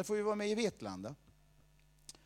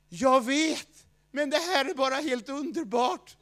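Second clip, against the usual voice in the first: delighted inner voice; 6 semitones higher, 6 dB louder, slower than usual. Retold or imitated. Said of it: "Vet du vad den sa?".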